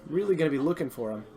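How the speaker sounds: ordinary talk, nothing marked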